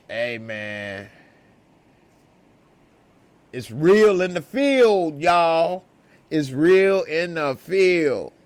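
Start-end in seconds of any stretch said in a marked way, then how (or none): 3.5-8.3 louder, slow and drawn out; emphatic declaration. Real, not acted. Telling viewers the danger is real, slow loud emphasis. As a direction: lean into the catchphrase. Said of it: "emphatic catchphrase".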